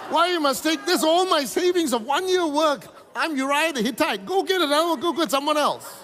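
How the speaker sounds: high, loud anguished plea to God; pleading owner. Acted. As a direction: protest like a man losing his savings. Pastor voices Uriah pleading with God.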